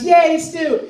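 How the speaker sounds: high, gleeful character cheer. Acted. Acting Al cheering to the camera about stew, high gleeful voice. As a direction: pitch it way up and cheer. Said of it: "Turns to the camera and goes".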